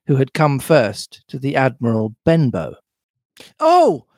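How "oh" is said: high, loud startled exclamation; crying out. A narrator's reading. Boy spotting a villain fleeing, high startled cry. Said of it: "I cried".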